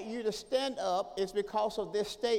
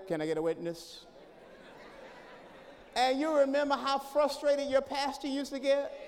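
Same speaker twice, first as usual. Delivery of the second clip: ordinary talk, nothing marked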